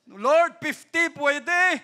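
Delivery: loud, high, drawn-out plea; calling out to God. Acted. Abraham haggling with the Lord over fifty, loud plea. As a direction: call up to heaven, slow and high.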